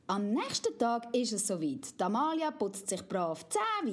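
ordinary delivery

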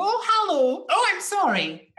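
high, loud mimicking of a caller's accent; Judy Finnegan. TV host slipping into a caller's accent, mimicking. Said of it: "Judy Finnegan does that too".

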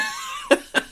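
very high squeal of laughter. Real, not acted. Shrieking laugh.

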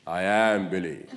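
low, slow, drawn-out declaration; grand reveal. Retold or imitated. Transformed colleague to a baffled coworker, grand reveal. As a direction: announce yourself with mock grandeur.

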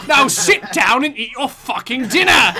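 mock scolding. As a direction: bark it like a fed-up parent. Mock-scolding Bruce as Alfred.

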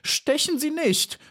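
very high, squealed plea; panicked high cry; screaming. A narrator's reading. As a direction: shriek in terror without much volume. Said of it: "schrie sie".

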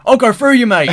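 put-on high, loud tough Glasgow accent; glasgow street accent. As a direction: belt it in a hard Glasgow accent. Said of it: "That's how she says it".

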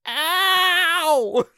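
put-on high squealing kid cry of pain; shrill little ow. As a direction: yelp like a hurt child. Kids hit the wall, cry out.

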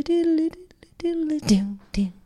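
normal talking voice

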